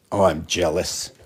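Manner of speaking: ordinary talk, nothing marked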